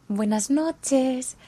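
slow, high sugary goodnight; sweetly mock the friend; teasing sing-song. Friend teasing after a spat.

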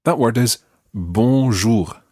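"bonjour" said slowly with nasal bon and French R; careful French "bonjour", nasal vowel and uvular R; slow model pronunciation. Shown as a demonstration. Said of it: "pronouncing it absolutely one hundred percent correctly".

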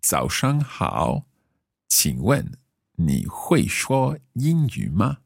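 slow, careful learner Mandarin; careful Chinese accent. Acted. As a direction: speak each syllable slowly, role-playing. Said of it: "doing my very best to use my best Chinese accent".

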